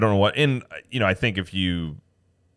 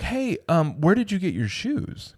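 high, soft, sweet asking; sugary high-pitched imitation; 10 semitones higher, 3 dB quieter, slower than usual. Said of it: "very sweetly was like".

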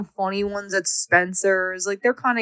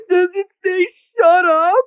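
put-on high, louder, teary little boy; crying kid voice; 10 semitones higher, 4 dB louder than usual. Crying four-year-old asking what the sticker says, high and teary. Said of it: "He's like".

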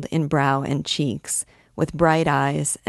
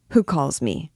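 ordinary delivery

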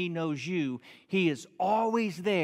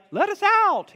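much higher, louder whiny dog voice; dog pleading; 7 dB louder, slower than usual. Retold or imitated. Dogs locked in the shed at night, high whiny begging. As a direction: beg to be let out like a trapped dog. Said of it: "in bark language".

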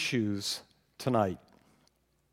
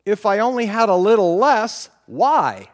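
high, loud whining wish; whiny wishing; 7 semitones higher, 11 dB louder than usual. Pretend. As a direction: wail it like a spoiled child.

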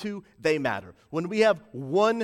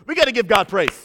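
raised, louder preaching voice; rallying praise; 4 semitones higher, 8 dB louder than usual. Real, not acted. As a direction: call the congregation to praise.

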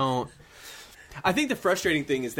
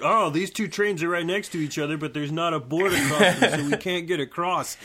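high, whiny mock of the game; 5 semitones higher, 4 dB louder than usual. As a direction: sneer it like a smug game character. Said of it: "it's like".

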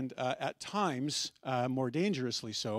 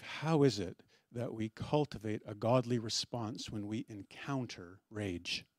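ordinary talk, nothing marked

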